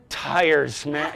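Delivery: hammed-up heartfelt sigh over tires with a laugh; mock emoting. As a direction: overplay the feeling, half laughing. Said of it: "I'm not emoting about tires".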